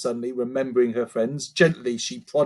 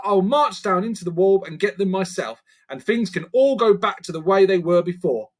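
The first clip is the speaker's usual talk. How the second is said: put-on higher girl's voice, determined; Lucy, defiant; 7 semitones higher, 3 dB louder than usual. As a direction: stand up to the creatures.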